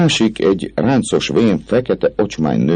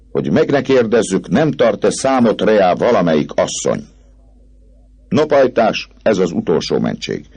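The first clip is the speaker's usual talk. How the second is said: Clopin's mocking speech; 9 semitones higher than usual. A narrator's reading. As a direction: lord it over the doomed poet. Clopin announces the gallows custom. (gúnyos, magas Clopin-hang)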